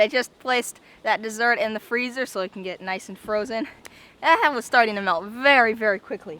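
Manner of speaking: ordinary talk, nothing marked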